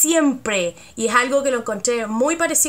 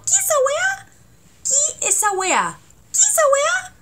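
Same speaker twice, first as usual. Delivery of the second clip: "¿qué esa weá?" shooting up high at the end; sharp upward Chilean question; rising Chilean intonation. Shown as a demonstration. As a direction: let "¿qué esa weá?" climb steeply. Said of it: "la entonación chilena va subiendo".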